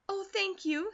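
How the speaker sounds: high, eager thanks; grateful girl. A narrator's reading. Girl touched by mother's offer.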